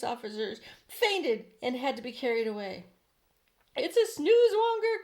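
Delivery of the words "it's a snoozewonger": high, loud frightened yell; panicked cry. A narrator's reading. Police chief crying out at the monster, high and loud. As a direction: cry out the monster's name in terror. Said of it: "cried the p-, chief of police".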